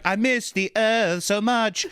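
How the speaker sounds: high, slow wistful voice; mock dying man. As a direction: sigh it out like on a deathbed. Fat guy on deathbed.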